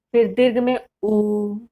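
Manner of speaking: उ held for a long vowel. Shown as a demonstration. Teacher demonstrates the long vowel उ to students. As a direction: stretch उ into a long, doubled vowel. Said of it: "इस प्रकार से बोलेंगे".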